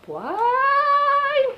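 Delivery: very high, long drawn-out squeal; high-pitched squeal. Real, not acted. Vlogger reacting to the fight question, high squeal.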